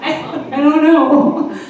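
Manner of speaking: dazed reply. Retold or imitated. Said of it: "And I'm like".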